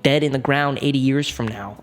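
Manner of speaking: normal talking voice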